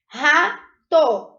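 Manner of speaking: Rato split clearly into ra-to; syllable demo. Shown as a demonstration. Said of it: "eu emiti dois sons".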